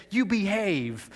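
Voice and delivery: a hint of stern command; mild scolding. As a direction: scold the storm like a stern parent, lightly. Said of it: "He, he scolded the storm".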